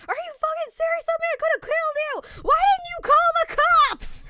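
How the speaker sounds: very high, yelled scolding; furious mom imitation. Mom cusses out daughter for not calling police, furious imitation. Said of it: "my mother was just cussing me out".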